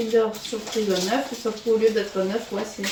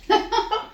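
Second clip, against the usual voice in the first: short high laugh; 12 semitones higher than usual. Real, not acted. Laughs at sticky candy.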